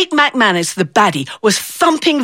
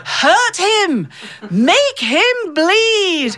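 high, hammed-up heckles from old ladies; bloodthirsty granny cheering, dragged out at the end; mock ringside yelling; 10 semitones higher, much slower than usual. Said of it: "were yelling things like".

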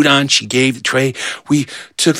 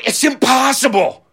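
higher, wailing defeat; despairing; 9 semitones higher than usual. Retold or imitated. Mike to the kids after Paul is caught, high despair. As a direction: throw up your hands, high and hopeless.

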